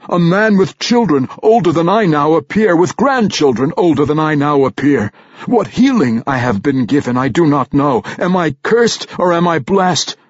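a warrior reeling in confusion; agitated barbarian. A narrator's reading.

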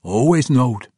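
Always knowed, falling and settled; calm statement. Acted. Bruner answering Emrys, calm and sure. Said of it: "Bruner says it plainly".

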